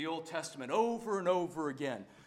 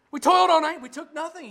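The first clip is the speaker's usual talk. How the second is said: loud, higher exasperated protest; Peter's weary protest; 10 semitones higher, 11 dB louder, faster than usual. Acted. Preacher voicing Peter's tired protest to Jesus, loud and exasperated. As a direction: protest that the night was wasted.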